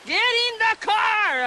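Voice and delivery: high-pitched re-enacted yell; retold scream. Mother re-enacting her panic at the girls, high screamed yell. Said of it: "And I was yelling, screaming".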